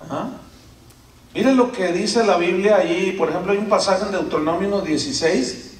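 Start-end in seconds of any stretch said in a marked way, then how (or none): none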